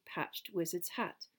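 ordinary delivery, nothing marked

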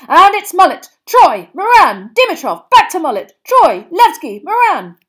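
loud, high rattled-off names; excited commentary. A narrator's reading. Bagman calls the chasers' passes in loud excited commentary. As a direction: call the passes as they happen.

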